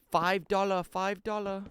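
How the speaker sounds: high put-on Vietnamese accent. Streamer repeating the Full Metal Jacket quote, high Vietnamese accent. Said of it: "That's why I could do the accent".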